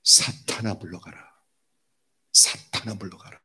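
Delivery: low command dropping to near whisper; strong hushed rebuke. Retold or imitated. Jesus sending Satan away from him, hushed stern rebuke. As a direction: rebuke intensely under the breath. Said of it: "엄청나게 강하게 얘기하시네요".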